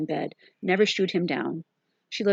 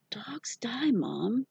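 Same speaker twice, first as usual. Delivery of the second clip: whispering; 6 semitones higher, 5 dB quieter, much slower than usual. A narrator's reading. Sick boy voices his fear.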